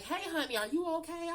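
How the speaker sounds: put-on high, gentle old lady; sweet old lady voice. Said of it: "She was like".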